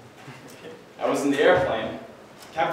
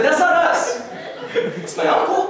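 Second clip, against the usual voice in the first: laughing protest; 10 semitones higher than usual. Real, not acted. Loud laughing denial.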